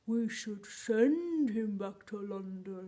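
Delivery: loud, slow, drawn-out silly voice; funny different voice. Pretend. Teacher demonstrates a different voice for pupils, loud and drawn out. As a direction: stretch the words in a booming put-on voice. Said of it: "Let's try that in a different voice".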